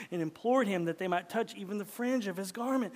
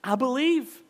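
louder, higher, proclaimed with conviction; emphatic declaration; 6 semitones higher, 11 dB louder than usual. Shown as a demonstration. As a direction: declare it like a creed. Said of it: "is to say".

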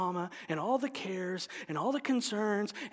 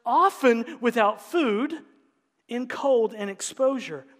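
raised, ringing scripture reading; preaching reading; 5 semitones higher, 4 dB louder than usual. Acted. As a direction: proclaim the hardships from the pulpit. Reads the apostle's hardships aloud.

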